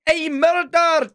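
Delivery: slow, high, hammed-up Scottish announcement with drumroll stings; dramatic Scottish reveal, last dun soaring; mock Scottish. Teasing friend about a murder at the mine, hammed-up Scottish. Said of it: "We wanna hear your best Scottish accent".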